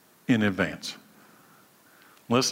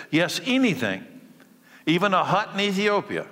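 higher, rising fervor; fervent surrender; 6 semitones higher than usual. Acted. Preacher urging total surrender, higher and fervent. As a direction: build to passionate yes. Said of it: "rising fervent commitment".

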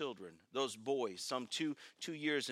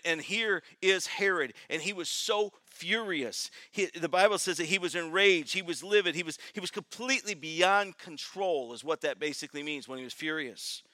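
ordinary talk

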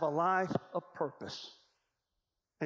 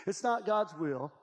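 ordinary talk

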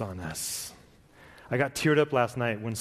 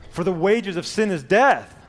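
loud, higher proclaiming of scripture; preaching boom; 7 semitones higher, 7 dB louder than usual. Acted. Preacher proclaiming verse to congregation, loud and high. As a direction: declare it to the whole room.

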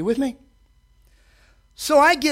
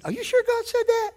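much higher, sneering doubt; mocking tempter; 13 semitones higher than usual. Preacher voices the serpent in Eden, sneering. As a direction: needle them with doubt.

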